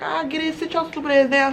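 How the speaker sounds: lightly voiced rude man, a hint of mockery; mock rude guy. Imagining what the guy would say, mock rude.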